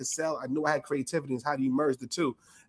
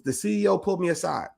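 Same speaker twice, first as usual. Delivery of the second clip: normal talking voice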